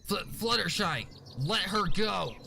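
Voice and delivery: high, insistent demand. A narrator's reading. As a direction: order her to let go, firm and high. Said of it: "Scootaloo demanded".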